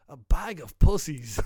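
slow, pondering mock voice; slow musing. [name] mulling over the phrase gee bag, slow pondering. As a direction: savour it as if thinking. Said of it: "kinda goes".

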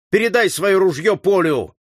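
high, loud command; furious shouted order. A narrator's reading. Enraged leader tells comrade to hand over rifle, shouting. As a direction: order it in exasperation. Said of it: "крикнул взбешённый Сорвиголова".

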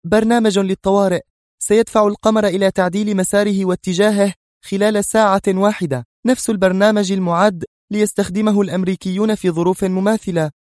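high-pitched, worked-up explanation; agitated. A narrator's reading. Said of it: "أجابته في انفعال". (بانفعال وصوت عال الطبقة)

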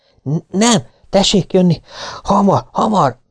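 high, breathless, stammering hurry; frantic urging. Acted. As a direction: drag the major along in panic. Frightened sergeant urges major. (magas, kapkodó, riadt sürgetés)

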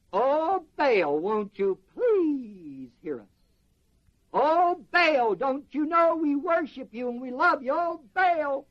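mock pleading wail, drawn out; moaning mock prayer to an idol; pleading prophets. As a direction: plead to Baal like a begging crowd. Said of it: "They called on the name of Baal, saying".

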